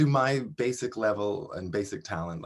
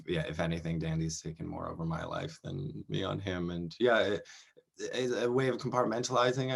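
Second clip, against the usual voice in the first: ordinary talk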